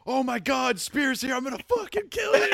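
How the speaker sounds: high-pitched hammed-up yell, cracking into laughter; mock furious yell. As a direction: shriek the threat while laughing at it. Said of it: "and he goes".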